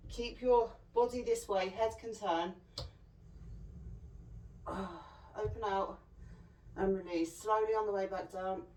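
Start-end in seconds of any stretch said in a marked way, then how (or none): 3.2-5.3 long, soft breathy sigh. Real, not acted. Coach exhaling through the exercise, long sigh.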